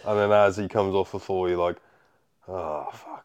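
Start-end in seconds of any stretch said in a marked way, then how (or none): 2.5-3.1 soft, sinking mock realisation; hushed acted dismay; quiet dread. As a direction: let it out under your breath, seeing disaster coming. Acting dread before the slam.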